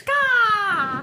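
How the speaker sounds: high, loud drawn-out caw; a mock crow's cry; crow call. Co-host showing off the special signal, loud high crow caw. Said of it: "The cawing".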